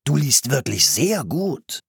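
admiring, drawn-out delight; enthusiastic. A narrator's reading. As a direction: savour the compliment with enthusiasm. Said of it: "sagte der Mann begeistert".

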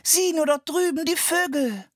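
put-on high, eager little girl calling out; excited call. A narrator's reading. Anne pulls her brother to the birds, calling out excitedly. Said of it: "rief Anne".

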